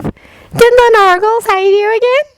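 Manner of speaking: put-on high, airy girlish lilt; childish sing-song. A narrator's reading. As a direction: float it up like a dreamy child. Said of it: "childish sing-song voice".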